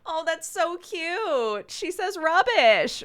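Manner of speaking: high-pitched, drawn-out mock gushing; exaggerated squeal of adoration; gushing coo. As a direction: coo slowly and loudly, way up high, as if over an adorable toddler. Acting out Americans cooing over a kid.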